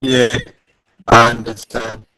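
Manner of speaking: ordinary delivery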